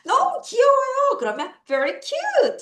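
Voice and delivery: high delighted coo; gushing over something cute. Acted. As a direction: squeal it like something adorable. Cooing over cute picture.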